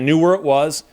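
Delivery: usual speaking voice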